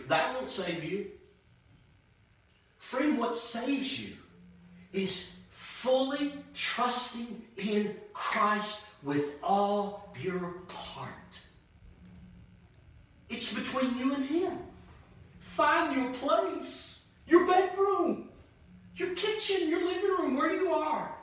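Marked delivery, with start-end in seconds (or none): 2.8-11.1 earnest appeal. Real, not acted. 13.3-21.2 high, soaring preacher's plea; impassioned preaching cry, rising high; fervent preaching. Real, not acted. Preaching climax, high and loud.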